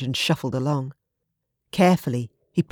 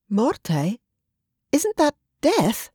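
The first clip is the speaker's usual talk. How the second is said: high, slow, stunned question; shocked; 8 semitones higher, much slower than usual. Acted. Olivia hearing the threatening message, shocked high question. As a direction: let the shock rise in her voice. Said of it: "Shock vibrated through Olivia's voice".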